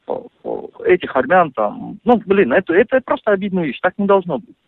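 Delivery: ordinary delivery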